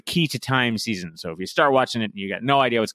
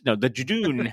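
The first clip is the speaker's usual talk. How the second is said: ordinary talk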